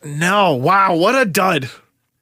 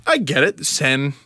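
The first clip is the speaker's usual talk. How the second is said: usual speaking voice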